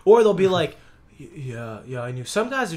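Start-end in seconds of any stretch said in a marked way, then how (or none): 1.2-2.2 much lower, much softer mumble; mock guilty low mumble; sheepish admission. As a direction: mumble it like a caught man. Caught man admits.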